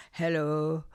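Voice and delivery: put-on German accent greeting. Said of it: "This is me doing a German accent".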